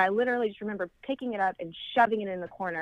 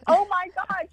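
high, louder gasping exclamation; pitched-up, stunned outburst; retold amazement; 11 semitones higher, 5 dB louder than usual. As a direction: imitate a stranger's astonished recognition. Acts out stranger's amazed recognition.

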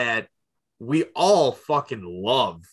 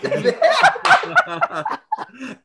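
much higher, loud howling laugh; big laugh; 5 dB louder than usual. Real, not acted. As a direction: shriek with laughter. Reacting to a kitty cat scene.

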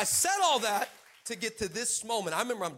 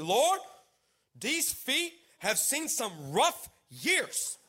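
slow, drawn-out prayer with rising words; halting awkward prayer; 4 semitones higher, much slower than usual. Retold or imitated. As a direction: pray haltingly, stretching out each word. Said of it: "This is how I started my prayer".

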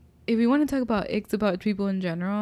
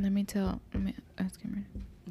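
unvoiced whisper; 11 dB quieter than usual. Real, not acted. Speaking low near sleeping kid.

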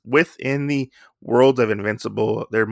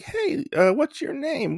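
high sing-song put-on voice; patronising; 5 dB quieter than usual. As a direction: talk down to her sweetly, much higher. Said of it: "he's like".

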